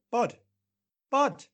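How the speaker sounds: calling across a bar; shouting. Pretend. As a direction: shout the name to get attention. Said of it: "make eye contact and shout".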